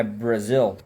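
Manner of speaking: Brazil. said with English R and a clear final L. Shown as a demonstration. Teacher repeating the word to show the R. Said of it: "Tem um R também".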